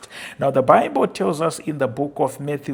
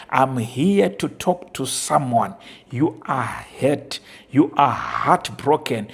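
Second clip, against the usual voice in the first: ordinary delivery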